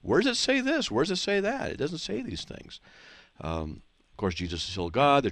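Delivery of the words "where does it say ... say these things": incredulous inner voice. Retold or imitated. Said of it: "and I'm like".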